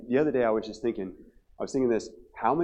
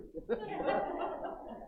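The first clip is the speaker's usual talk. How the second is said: airy laugh; 9 dB quieter than usual. Real, not acted. Laughs at own story.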